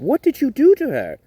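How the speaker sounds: high, loud demand; Timothy demanding. Acted. Timothy demanding of King Triton what he did, high and loud. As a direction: confront the king.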